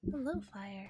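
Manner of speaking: ordinary delivery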